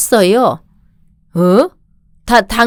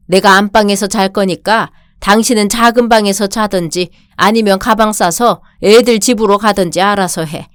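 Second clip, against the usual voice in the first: ordinary delivery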